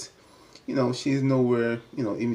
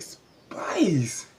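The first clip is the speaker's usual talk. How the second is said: shocked call-out; 8 semitones higher than usual. Real, not acted. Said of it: "I was like".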